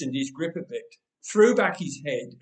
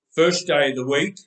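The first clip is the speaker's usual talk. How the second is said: grunted; 2 semitones lower, 7 dB louder than usual. Acted. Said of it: "Big Hand Man grunted".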